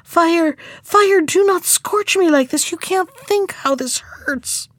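high, pained storybook plea; acted agonized appeal; crying out in pain. A narrator's reading. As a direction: cry out as if scorched, pitched well up. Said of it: "crying out in pain".

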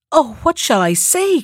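a frightened girl's cry, lightly voiced; anxious cry. A narrator's reading. Beauty, afraid to refuse the beast, cries out anxiously. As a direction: ask it in a small panic, afraid to anger him. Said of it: "cried Beauty".

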